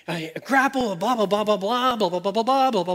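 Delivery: babbled run of blahs; dismissive imitation of someone making excuses; mock rambling excuse. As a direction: rattle off the objection as empty babble. Mimics an excuse-maker's rambling objection.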